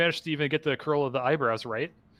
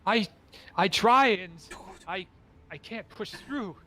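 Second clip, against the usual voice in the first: higher, halting, fading off; despairing trapped man; 6 semitones higher than usual. Acted. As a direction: struggle to get the words out. Trapped figure explains failed escape.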